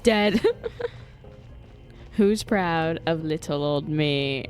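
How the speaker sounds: ordinary talk, nothing marked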